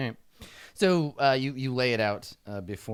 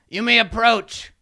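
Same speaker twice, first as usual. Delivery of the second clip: much higher, louder command; imperious kobold king; 10 semitones higher, 9 dB louder, slower than usual. Acted. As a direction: grant an audience grandly. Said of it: "and he says".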